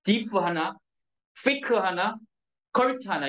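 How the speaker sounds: diff, thick, cult counted out one at a time with pauses between; syllable by syllable. Shown as a demonstration. Teacher counting off the three syllables of difficult for students, syllable by syllable. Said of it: "삼 음절이에요".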